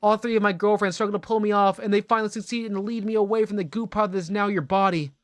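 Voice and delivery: ordinary talk, nothing marked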